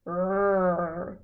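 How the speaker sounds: low bear growl; a lightly voiced low growl. A narrator's reading. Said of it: "growled Old Bear".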